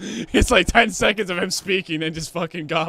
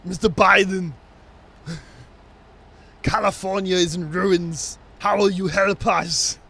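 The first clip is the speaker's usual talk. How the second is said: put-on higher, booming Austrian-accented appeal; Arnold Schwarzenegger; 4 semitones higher, 6 dB louder than usual. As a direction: put on a big Austrian action-star accent. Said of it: "just Arnold Schwarzenegger just going".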